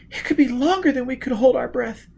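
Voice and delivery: put-on high, tense girl's voice; frightened young woman. A narrator's reading. Gisbella protesting to Foyle that there is no air. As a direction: pitch up, sound scared.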